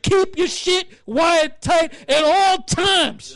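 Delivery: slow, loud, stretched-out order; a drill sergeant yelling each word at his troops; drill sergeant bark. Retold or imitated. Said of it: "He said".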